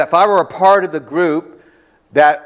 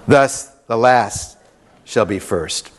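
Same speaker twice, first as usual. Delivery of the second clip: slow solemn reading; 5 semitones lower, slower than usual. Acted. Reads closing verse slowly.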